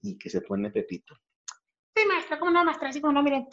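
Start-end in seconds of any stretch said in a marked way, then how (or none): 1.9-3.5 high, cheeky schoolboy; Pepito's voice. Acted. Pepito reciting a verse to his teacher, high.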